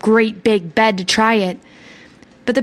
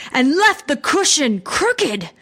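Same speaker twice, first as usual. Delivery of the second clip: slow, great big bear voice; growling father bear; 3 semitones higher, slower than usual. A narrator's reading. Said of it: "growled the father bear in his great big voice".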